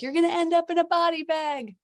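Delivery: high, loud teasing warning; mock alarmed friends. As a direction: play the worried friend, way up high. Friends warn her mockingly.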